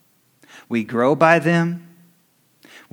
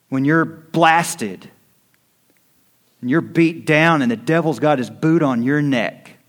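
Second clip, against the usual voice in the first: rising preaching intensity; 4 semitones higher, 4 dB louder than usual. Acted. Preacher building to climax.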